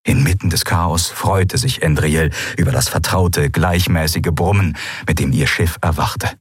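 normal talking voice

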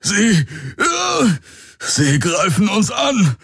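high, halting, tormented warning; agonised gasping. A narrator's reading. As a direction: choke out the warning in pain. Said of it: "keuchte Hox gequält". (gequält und stockend gekeucht)

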